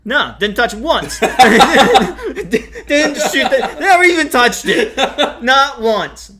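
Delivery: high, loud words through laughter; laughing. Real, not acted. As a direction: laugh at your own failure. Laughing at own miss.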